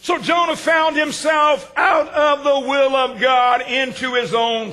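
slow, loud preaching cry; preaching shout. Acted. Preacher crying out to the congregation, slow and loud. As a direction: lift it like a preacher at full force.

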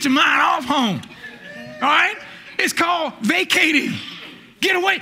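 high, ringing preacher's cry; full-force preaching. Acted. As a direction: drive the point home to the church.

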